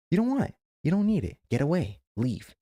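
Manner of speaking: high, loud and forceful; firm dismissive command; emphatic loud order. Real, not acted. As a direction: push them away with your voice. Urging listener to cut people off.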